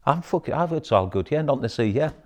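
hammed-up everything's fine front; fake bravado. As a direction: put on a cheery fake front. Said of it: "the type of man I portray".

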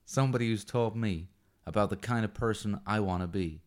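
put-on deliberately poor Yankee Doodle American accent; bad American accent. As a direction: read the movie speech in your worst American accent. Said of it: "words in my worst American accent".

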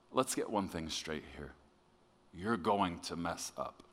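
low, soft and tender; very sweet. Retold or imitated. Holy Spirit gently setting the preacher straight, very sweetly. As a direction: speak it gently, low and unforced. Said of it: "very sweetly say".

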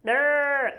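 sheep-like yelp, demonstrated; weird sheep noise. Shown as a demonstration. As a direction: imitate a startled sheep. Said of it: "that weird sheep noise".

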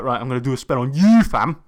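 loud London roadman swagger; london roadman accent. Retold or imitated. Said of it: "he was talking like a roadman".

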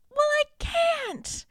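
high, loud, drawn-out wail; hammed-up heartbroken cry; mock anguished outburst. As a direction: wail it like a melodramatic heroine. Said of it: "they're breaking up".

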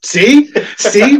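high, loud "told you so"; vindicated. Real, not acted. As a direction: pounce on being proved right. Vindicated in earlier argument.

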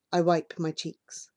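plain reading voice

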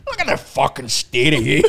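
loud, mocking Northern Irish; camp Northern Irish accent. Teasing his co-guest in improvised chat, loud Northern Irish. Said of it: "Let's talk the next minute or two like this".

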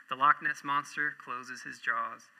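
ordinary talk, nothing marked

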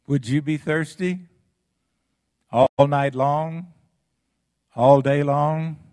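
rising preacher's challenge; preaching emphasis. Real, not acted. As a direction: press the congregation hard. Preacher pressing rhetorical questions.

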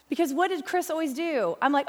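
usual speaking voice

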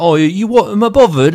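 loud, high mock Cockney; exaggerated Cockney English, challenging; Cockney accent. Shown as a demonstration. Showing the English part of the blend, loud Cockney accent. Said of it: "like, Cockney English and Irish".

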